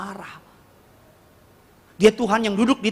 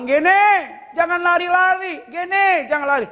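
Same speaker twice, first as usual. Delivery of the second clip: put-on high, loud call after a running kid; calling out to child; 11 semitones higher, 4 dB louder, slower than usual. Father calling to his running son, raised warning call. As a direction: call him off before he falls.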